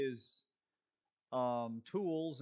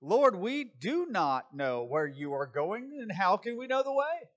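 louder and higher at the end, a confused disciple; puzzled Thomas; 5 semitones higher, 7 dB louder than usual. Retold or imitated. Thomas asking Jesus where he is going, bewildered and loud. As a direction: call out in bewilderment, climbing high. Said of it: "he goes".

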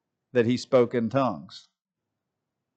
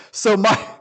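usual speaking voice

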